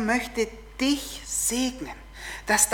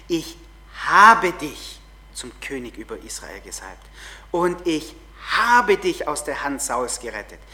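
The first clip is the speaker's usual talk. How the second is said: louder, heavily stressed Bible reading; emphatic reading; 4 semitones lower, 5 dB louder than usual. Shown as a demonstration. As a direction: lean hard on ich and habe. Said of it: "achtet mal auf die Betonungen".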